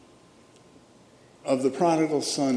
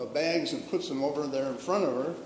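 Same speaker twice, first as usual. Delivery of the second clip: normal talking voice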